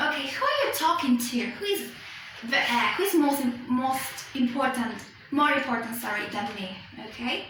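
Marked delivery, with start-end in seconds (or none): 0.3-1.4 are stressed and held, quick question; ARE said strong and full, not weak. Shown as a demonstration. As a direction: lean on the word are in who are you talking to. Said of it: "making emphasis on".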